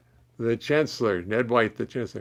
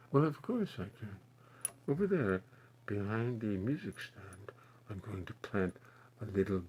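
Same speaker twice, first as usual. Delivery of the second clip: much softer, hushed painter's murmur; Bob Ross voice; 3 semitones lower, 12 dB quieter than usual. Pretend. Asked for Bob Ross, answers in a soft hushed painter voice. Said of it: "Can you do a Bob Ross voice?".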